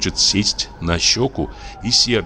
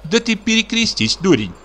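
scolding wife. A narrator's reading.

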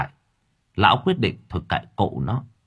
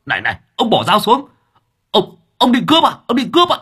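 much higher, louder frantic cry; shrill panic; 12 semitones higher, 6 dB louder, faster than usual. A narrator's reading. Driver Hà seeing the knife, shrieking in panic.

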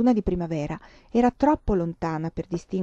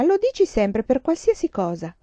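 put-on whining child; 6 semitones higher, 4 dB louder than usual. A narrator's reading. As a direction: complain like a sulky little girl. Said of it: "si lamentò la bambina". (bambina che si lamenta, più acuta)

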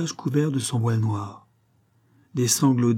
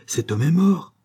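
higher, rising cry of alarm; shocked exclamation; 7 semitones higher, 4 dB louder than usual. A narrator's reading. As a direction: blurt out the shocking discovery. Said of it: "s'écria-t-il".

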